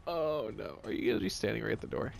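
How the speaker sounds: usual speaking voice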